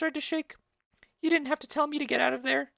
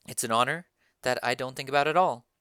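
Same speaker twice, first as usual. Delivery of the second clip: lightly voiced young girl, lower; Juliet. Juliet answers her mother about marriage, lightly voiced. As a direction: demure daughter answering.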